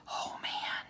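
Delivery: soft, breathless whisper; awed whisper. Acted. Jack seeing the huge castle, whispering in wonder. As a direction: gasp it out in awe.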